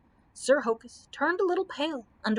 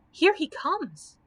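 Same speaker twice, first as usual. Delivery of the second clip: frightened; 6 semitones higher, 4 dB louder, slower than usual. A narrator's reading. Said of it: "shuddered Dorothy".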